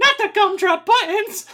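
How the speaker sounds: very high, loud cartoon squeal; squeaky Mickey Mouse-like. Co-host mock-pleading for gumdrop buttons, squeaky Mickey Mouse-like. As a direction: squeal it like a little cartoon character. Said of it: "Why did I sound like Mickey Mouse".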